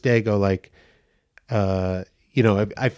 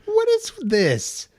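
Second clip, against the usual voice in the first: high, stunned disbelief; incredulous. Retold or imitated. Reacting to the feminine note, high incredulous. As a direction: squeak it out in disbelief. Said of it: "And I was like".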